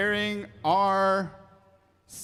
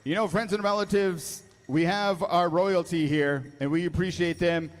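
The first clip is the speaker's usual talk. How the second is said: powwow emcee. Acted. Powwow emcee introducing honor guard.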